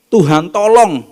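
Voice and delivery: loud, high cry for help; mother begging the Lord for help; pleading cry. Acted.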